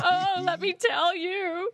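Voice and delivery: high, slow hammed-up crying; mock tearful wail. Daughter reenacting pouring her heart out to dad, mock wailing. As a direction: wail it out as if bawling. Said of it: "waterworks would start flowing from my eyes".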